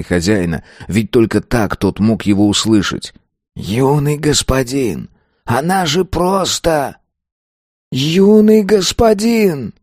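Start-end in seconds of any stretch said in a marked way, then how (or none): 3.5-7.0 pleading spirit. A narrator's reading. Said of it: "невероятно тихим". 7.9-9.7 much higher, slow calling out; desperate cry. A narrator's reading. Ghost cries out.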